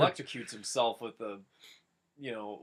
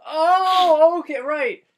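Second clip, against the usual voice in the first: surprised oh; 14 semitones higher, 13 dB louder, slower than usual. Real, not acted. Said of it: "listener's exclaim".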